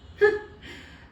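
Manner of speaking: breathy evil laugh; wicked laugh. A narrator's reading. Wife cackles to her husband about the bet, wicked laugh. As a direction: cackle at the plan. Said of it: "and she laughed".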